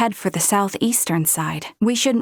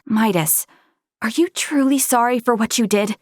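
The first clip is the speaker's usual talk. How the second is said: ordinary talk, nothing marked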